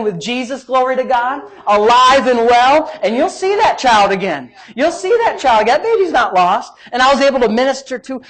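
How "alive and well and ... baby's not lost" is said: preaching at full force. Real, not acted. Preaching comfort to listeners.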